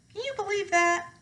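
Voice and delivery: usual speaking voice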